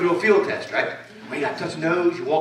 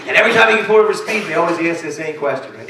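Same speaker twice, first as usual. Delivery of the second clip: usual speaking voice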